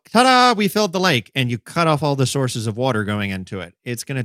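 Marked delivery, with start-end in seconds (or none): 0.1-1.3 higher, louder sing-out of triumph; mock triumphant. Host mocks people declaring the lake filled, mock triumphant. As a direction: announce it like a proud reveal. Mock celebrating in a retold quote.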